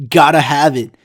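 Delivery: sneering loud mock of the size name. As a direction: spit the name out in disgust. Mocking the silly size name.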